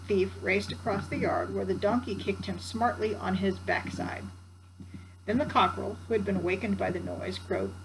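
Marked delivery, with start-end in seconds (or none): none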